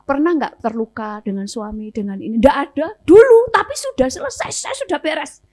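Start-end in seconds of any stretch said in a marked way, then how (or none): 2.4-5.4 high-pitched mock protest; defensive denial. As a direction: imitate someone hastily insisting all is settled, pitched up. Acted denial of any bitterness. (menirukan bantahan defensif bernada tinggi)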